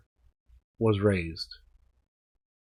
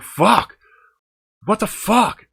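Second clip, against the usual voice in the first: loud frustrated yell, pitched much higher; angry outburst; 11 semitones higher, 5 dB louder, slower than usual. Retold or imitated. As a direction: blow up at the news of the bill. Exploding at the price.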